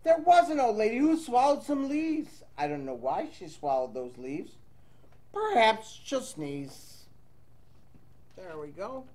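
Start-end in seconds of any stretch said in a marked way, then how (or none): none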